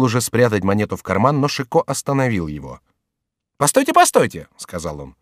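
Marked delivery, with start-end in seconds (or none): 3.6-4.5 very high, quick-witted halt; high-pitched urgent call; urgent interruption. Acted. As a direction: stop them with a high exclamation. Chicot stops the monk.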